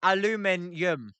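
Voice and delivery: "Aluminium" with the Y glide, al-yoo-MIN-yum; "Aluminium" as al-u-MIN-yum, a bit unsure; trying the British way. Shown as a demonstration. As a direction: attempt "Aluminium" with a y sound after the first syllable. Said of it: "I can't say it".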